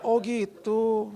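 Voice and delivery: high, drawn-out acknowledgement; slow realisation. Retold or imitated. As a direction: stretch it out as the penny drops. Sheepish reply to wife's explanation.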